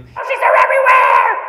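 high, loud frantic Toad shout. Acted. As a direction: scream it as a panicked Toad.

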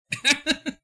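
high giggling laugh; a sudden high laugh at the screen; burst of laughter. Real, not acted. Streamer cracking up at a friend's drawing, high laugh.

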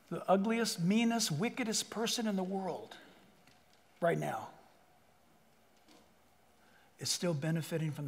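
quiet earnest sermon voice; hushed preaching. Real, not acted. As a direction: draw them in with a hush. Preacher drops to hushed intensity.

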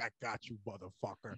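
low, growled gotcha line; mock gotcha taunt. Retelling the friend's gotcha taunt, low and growled. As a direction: taunt him like you caught him out.

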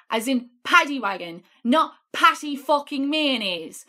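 ordinary talk, nothing marked

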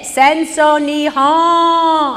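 slow, loud, high drawn-out shout; loud slow accusing shout in Japanese. Retold or imitated. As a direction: yell it across the cafe. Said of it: "when I hear shouting".

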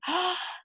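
sharp delighted gasp; wonder gasp. Acted. Teacher gasping at pretend sea creatures, amazed. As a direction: gasp at something amazing.

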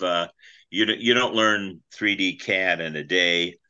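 ordinary talk, nothing marked

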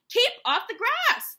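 high, loud order; angry shout. A narrator's reading. Hank warns a passing cowboy off, shouting. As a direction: yell at a trespasser. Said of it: "shouted Hank".

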